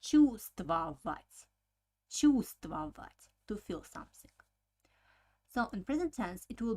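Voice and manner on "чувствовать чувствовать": чувствовать said slowly as "чуствовать"; silent first V. Shown as a demonstration. Teacher repeating the word so students hear the mute V. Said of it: "the Russians don't pronounce".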